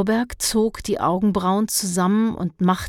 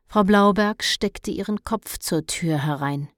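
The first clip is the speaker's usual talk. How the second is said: plain reading voice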